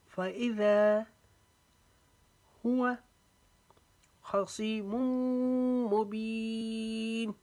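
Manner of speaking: high, slow, chanted verse with long pauses; full tajweed recitation. Shown as a demonstration. Teacher recites the whole verse for students in full tajweed. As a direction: recite the verse slowly, pausing between phrases. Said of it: "वक्फे के साथ पढ़ते हैं".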